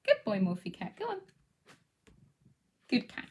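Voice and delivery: ordinary talk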